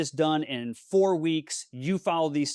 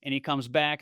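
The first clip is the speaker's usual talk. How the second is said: ordinary delivery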